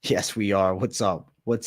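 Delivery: ordinary delivery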